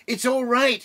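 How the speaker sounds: high, loud excited shout from outside. Acted. Beaver shouting to the hidden family outside the cave, loud and high. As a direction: call out loud and high with relief. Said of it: "he was shouting".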